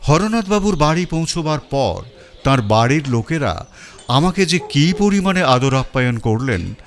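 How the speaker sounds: usual speaking voice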